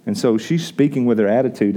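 normal talking voice

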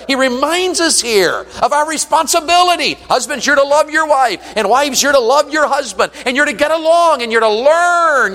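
high, driving preacher's exhortation; full-force sermon delivery, high and insistent; impassioned preaching. Real, not acted.

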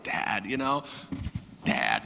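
low, very quiet call to dad; retold dejection, voice sunk low; bummed. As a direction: say it small and defeated. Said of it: "I was, like, bummed".

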